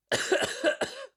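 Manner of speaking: spluttering cough from spice; harsh coughing fit. Real, not acted. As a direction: hack out a rough cough. Choking on pepper fumes.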